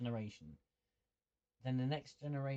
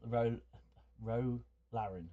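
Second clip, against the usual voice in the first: ordinary talk, nothing marked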